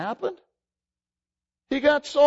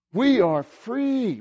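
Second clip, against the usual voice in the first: usual speaking voice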